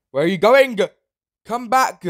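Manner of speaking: high, loud chasing yell; shrieking pursuit; frantic shout. Real, not acted. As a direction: call after them desperately. Chasing fleeing player.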